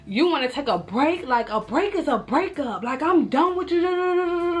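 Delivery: going off. Retold or imitated. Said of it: "I'm going in".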